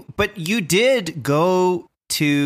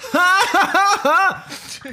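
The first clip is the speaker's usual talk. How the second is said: big risky laugh. Shown as a demonstration. Host showing a laugh that means trouble, big high guffaw. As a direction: laugh out hugely, far above talking pitch. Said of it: "if you laugh like this".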